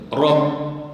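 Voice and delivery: isolated "Rabb" with a pressed double b; shaddah stressed. Shown as a demonstration. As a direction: hit Rabb firmly on the closing bb. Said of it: "di situ ada syaddah".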